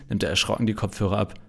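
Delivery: usual speaking voice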